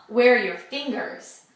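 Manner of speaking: usual speaking voice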